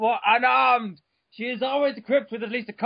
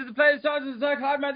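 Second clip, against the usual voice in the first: usual speaking voice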